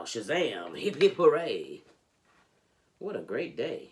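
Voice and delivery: ordinary delivery, nothing marked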